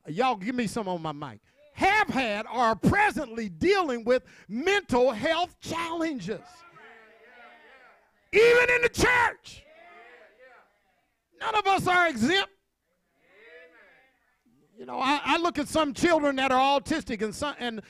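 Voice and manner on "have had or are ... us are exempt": high, rising sermon cry with stretched words; impassioned preacher reaching a peak; full-force preaching. Real, not acted. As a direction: build to a loud shout for the congregation.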